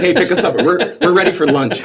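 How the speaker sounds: high, loud retold request, laughing; laughing call-out. Drinkers calling their driver from the bar, loud and laughing. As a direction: call out merrily to your ride.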